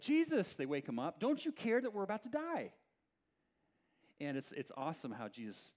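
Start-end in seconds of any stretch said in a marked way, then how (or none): 0.0-0.5 high, loud cry to wake him; scared disciple. Retold or imitated. Disciples waking Jesus in the storm, alarmed. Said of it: "are scared for their lives". 1.2-2.7 high, urgent protest; incredulous, scared disciple. Retold or imitated. Frightened disciples confronting a sleeping Jesus, incredulous. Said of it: "they are incredulous".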